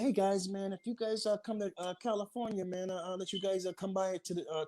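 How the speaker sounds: retold casual invitation, lifted in pitch; imitating Jack Gordon. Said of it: "and he's like, uh".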